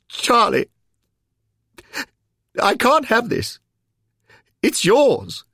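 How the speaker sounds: high, loud, emotional exclamation; overwhelmed by the gift; overcome protest. Acted. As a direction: protest in disbelief, voice leaping up. Henry tearfully refuses the medal.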